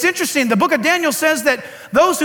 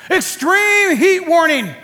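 slow, higher mock weather-warning announcement; mock news alert; 5 semitones higher, 3 dB louder, slower than usual. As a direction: read it like a hammed-up TV alert. Mocking the weather news report.

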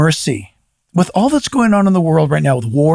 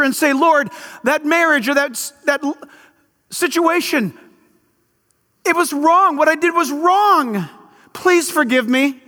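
high, raised preaching voice; preaching at full force; 11 semitones higher than usual. Acted. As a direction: drive it home to the church.

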